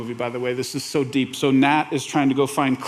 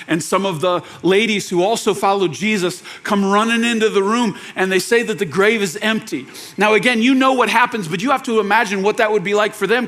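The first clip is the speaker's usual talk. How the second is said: normal talking voice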